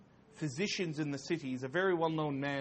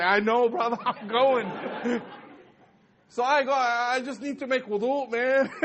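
frightened excuse; 7 semitones higher, 8 dB louder than usual. Retold or imitated. Scared teen excusing himself.

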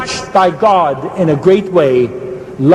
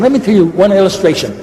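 normal talking voice

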